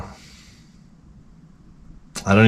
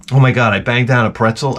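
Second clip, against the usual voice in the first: ordinary talk, nothing marked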